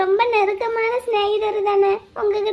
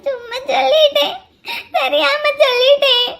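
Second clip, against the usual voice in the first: tearful wail, pitched higher; crying; 4 semitones higher, 4 dB louder than usual. Acted. Girl confessing her mistake to the tea seller, crying. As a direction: sob out the confession helplessly. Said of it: "நின்னு அழுகாதம்மா".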